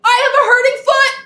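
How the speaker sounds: very high, loud, fast shout. Acted. Child shouting to the neighbour from far behind, very high and loud. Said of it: "so I had to shout".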